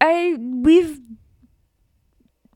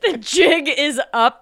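mock dramatic; 6 semitones higher, 5 dB louder, much slower than usual. Dramatic repeat of partner's phrase.

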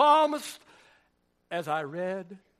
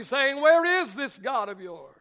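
normal talking voice

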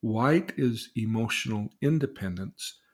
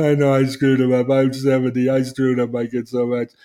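put-on guilt-ridden old parent; 3 semitones higher, 10 dB louder than usual.

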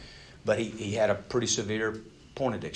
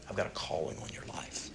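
softly whispered promise; a hushed, intimate voice of God; tender whisper; 5 semitones lower, 10 dB quieter than usual. Acted. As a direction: lean in and whisper the calling. God speaking to Jacob.

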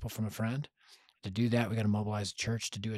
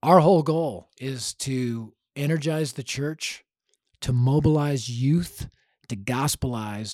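slow, louder mission slogan; emphatic slogan; 3 semitones higher, 7 dB louder, slower than usual. Acted. Founder stating Dare to Share's mission, slow and emphatic. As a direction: spell out the mission phrase by phrase.

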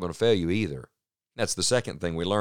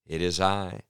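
ordinary talk, nothing marked

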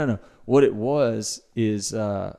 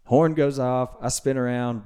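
ordinary talk

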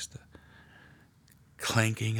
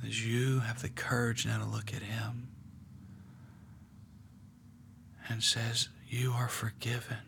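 guided meditation; 5 dB quieter than usual. Acted. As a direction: draw them gently into the scene. Leads a guided prayer meditation.

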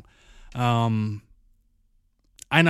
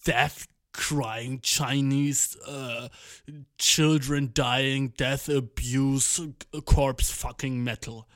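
slow, drawn-out mock-grim listing; mock metal genre; much slower than usual. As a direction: spell out ridiculous metal genres slowly. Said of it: "er so, Ja, das ist".

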